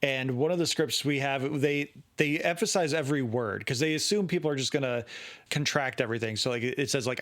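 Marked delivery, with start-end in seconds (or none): none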